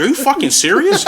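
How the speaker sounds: high-pitched, incredulous mock yell; retold yell. Customer recalls confronting a greedy stranger, retold yell. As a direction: mimic yelling in disbelief without full volume. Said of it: "I yelled at him".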